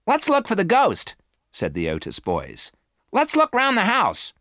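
put-on eager boys. A narrator's reading. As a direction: chirp it like keen young boys.

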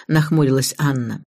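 ordinary delivery

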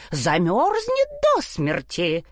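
ordinary delivery, nothing marked

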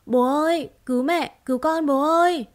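put-on high, loud desperate boy calling for help; a boy cries out to his father through the crowd; hoarse child's cry. A narrator's reading. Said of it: "giọng nói khản đặc".

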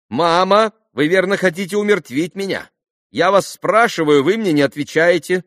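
high, loud, petulant complaint; exasperated girl. A narrator's reading. Lise berates her mother for not answering, high and exasperated. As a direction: scold mother for ignoring you.

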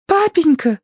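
put-on very high girlish squeal; exclaiming daughter. A narrator's reading. Daughter calls to her papa, exclaiming. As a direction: cry out in a high little-girl voice. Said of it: "воскликнула Тосси".

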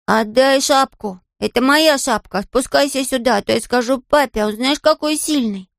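put-on high-pitched childish demand; high child voice making threats; little boy protesting. A narrator's reading. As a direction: demand it back like an indignant small child. Little boy threatens squirrel over hat.

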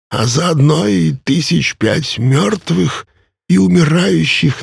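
put-on high, slow, boastful old ferryman; a proud immortal savoring every word; ancient ferryman voice.

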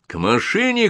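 high called-out order; shouted command. A narrator's reading. Lieutenant ordering men to the vehicle, a high contained shout. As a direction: call the men over, pitched high. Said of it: "крикнул я".

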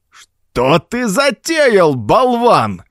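high, drawn-out angry growl; belligerent growl. A narrator's reading. Old Baines rounds on Gordon, in a belligerent growl. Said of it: "прорычал воинственный Бэйнс".

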